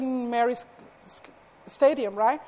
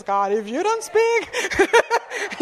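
mock panic; 7 semitones higher, much slower than usual. Panicked inner plea.

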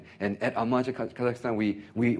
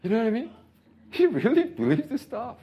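much higher and louder, laughing through it; laughing disbelief; 4 dB louder than usual. Real, not acted. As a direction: crack up in disbelief.

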